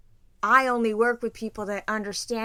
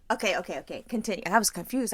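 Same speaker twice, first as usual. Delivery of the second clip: normal talking voice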